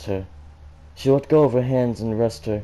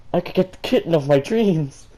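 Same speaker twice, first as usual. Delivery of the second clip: giggling through the words into a high laugh; can't keep a straight face; real laughter while reading; 9 semitones higher, 4 dB louder than usual. Real, not acted. Reader laughs at line.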